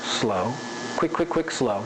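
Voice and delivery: low long "slow", rapid "quick" thrice, low "slow"; changing the pace. Shown as a demonstration. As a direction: hold "slow", rattle off "quick", settle back down. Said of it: "that's changing the pace".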